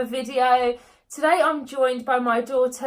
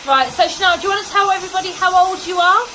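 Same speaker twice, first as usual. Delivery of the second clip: raised voice over the noise; over hair dryer; 3 semitones higher than usual. Real, not acted. Talking over running hair dryer.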